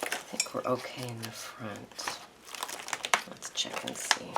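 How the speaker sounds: whispered to herself while checking; a soft aside under her breath. Real, not acted. Checking the book, muttering to herself.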